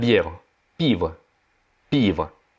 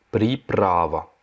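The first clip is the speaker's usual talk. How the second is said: Приправа drawn out very slowly; slow repeat; 2 semitones lower, much slower than usual. Shown as a demonstration. Teacher repeats the word for French learners, very slowly. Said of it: "c'est приправа".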